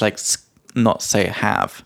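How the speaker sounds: usual speaking voice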